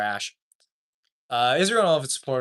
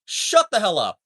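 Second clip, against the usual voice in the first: higher-pitched exasperated snap; angry outburst; 11 semitones higher than usual. Real, not acted. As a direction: tell them off, fed up. Angry rant at Israel's defenders.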